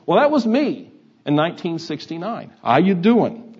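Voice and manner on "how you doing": loud, high New Jersey greeting; New Jersey accent. Retold or imitated. New Jerseyan greeting a Texan in nineteen sixty-nine, New Jersey accent. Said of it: "We s-, say it with a different accent".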